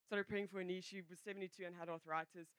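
ordinary talk, nothing marked

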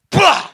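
high, loud mock raspberry of dismissal; mocking blah. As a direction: blurt a bratty blah. Daughter brushing dad off.

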